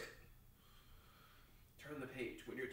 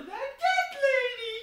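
much higher, much louder squeal; squealing shout; 15 dB louder than usual. Real, not acted. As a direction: squeal it at the top of your lungs. Squeals line loudly, twice.

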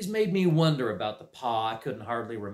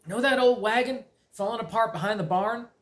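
put-on raised, eager country boy voice; young farm boy; 9 semitones higher than usual. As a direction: ask with a sly hint of a plan. Simon asks uncle.

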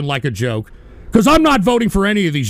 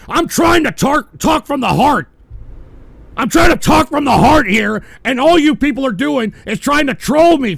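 louder and higher, furious at the trolls; angry shouting; 4 semitones higher, 3 dB louder than usual. Real, not acted. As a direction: blast the trolls who won't take you seriously.

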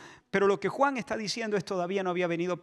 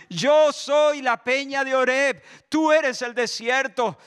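"Yo soy la peña de Oreb" slow and loud, higher; loud proclamation; 5 semitones higher, 7 dB louder, slower than usual. Acted. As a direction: declare "Yo" with full force. Repeated proclamation, then hushed.

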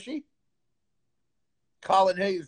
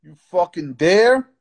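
louder, slow, each word pushed out; angry slow challenge; 8 dB louder, slower than usual. Real, not acted. Streamer taunting a caller who won't show his face, angry loud challenge. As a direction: dare him, loud and slow.

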